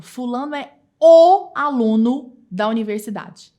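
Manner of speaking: "o" dito forte e lento, destacado; stress on o. Shown as a demonstration. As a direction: aperte o "o" com força. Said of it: "dependendo do tom que eu imprimo à minha fala". (ênfase no "o", alto e devagar)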